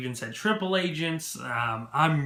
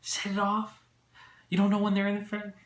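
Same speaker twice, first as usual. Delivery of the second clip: mock incredulous, voice pitched up; 7 semitones higher than usual. Vlogger mocks the reporter's question, incredulous. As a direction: act baffled at the question. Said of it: "But I was just like".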